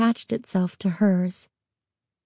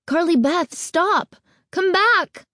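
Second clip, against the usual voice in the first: high calling out; pleading call; 10 semitones higher, slower than usual. A narrator's reading. Friend calling after fleeing girl, high pleading call. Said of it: "Sabrina's pleas".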